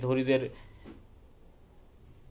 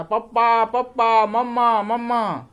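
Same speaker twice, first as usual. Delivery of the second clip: high, loud calls for his parents; boy yelling; 8 semitones higher, 8 dB louder, slower than usual. Acted. Son in pain calling loudly for his parents, high and loud. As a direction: cry out for mom and dad in pain. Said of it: "Bunty जोर से चिल्लाता".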